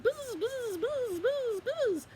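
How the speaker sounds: high, loud bee buzzing. Acted. Bees Quackling suddenly hears on the road, high buzzing. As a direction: buzz in high repeated bursts. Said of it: "all of a sudden he heard".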